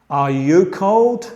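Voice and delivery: "Are you cold?" with the pitch climbing on "cold"; rising question tune. Shown as a demonstration. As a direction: lift "cold" up at the end like a question. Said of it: "la entonación va subiendo en el fin". ("cold" con entonación que sube al final)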